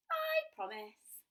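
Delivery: put-on high, slow little girl. Girl voiced in story.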